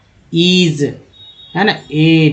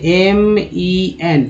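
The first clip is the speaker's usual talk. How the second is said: M E N spelled slowly, letter by letter; slow spelling out; much slower than usual. Shown as a demonstration. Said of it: "क्या बोलते हैं?". (धीरे-धीरे spelling)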